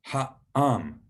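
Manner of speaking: ha'am with a catch in the throat between ha and am; ha'am said ha-am, air stopped in the middle; glottal stop in ha'am. Shown as a demonstration. Teacher models ha'am, glottal stop. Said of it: "beginning with that glottal stop".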